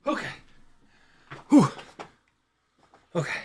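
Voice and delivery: breathless after running; panting between words; out of breath. Real, not acted. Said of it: "Now I'm out of breath".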